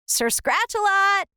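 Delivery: high calling out. A narrator's reading. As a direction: call for the lost cat. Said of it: "I called and called".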